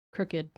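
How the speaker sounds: crooked said in two syllables, "crook-ed"; crooked with the -ed ending sounded. Shown as a demonstration. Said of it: "Usually people say".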